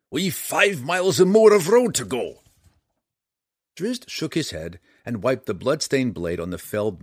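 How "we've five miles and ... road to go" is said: scolding dwarf, higher and louder; gruff gravelly dwarf voice. A narrator's reading. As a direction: scold him like an impatient dwarf. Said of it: "scolded the dwarf".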